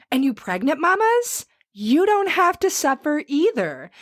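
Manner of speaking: upbeat, high-pitched sponsor read. Acted. Host urging allergy sufferers to try a remedy, upbeat ad read.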